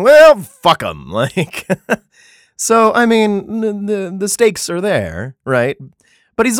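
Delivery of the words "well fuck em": slow, loud blunt dismissal; dismissive. Retold or imitated. God dismissing the people who won't listen, slow and blunt. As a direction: shrug them off with a slow punch.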